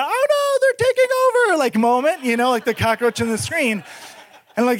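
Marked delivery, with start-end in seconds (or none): 0.0-1.6 high, squealing mock alarm; mock panic cry. Pranking buddy Paul on Skype, hammed-up panicked squeal. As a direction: squeal it like a fake horror bit. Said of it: "and do, like, a".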